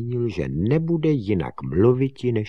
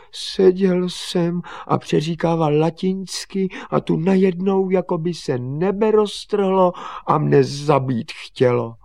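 high, loud, upset account; distressed boy; 10 semitones higher, 5 dB louder than usual. Acted. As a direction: wail out what just happened. Drenched boy tells his fright.

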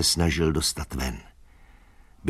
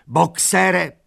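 high, loud call of a name; shouting; 12 semitones higher, 5 dB louder than usual. A narrator's reading. Mare runs after the cart, shouting. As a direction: call out urgently after someone. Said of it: "křičela".

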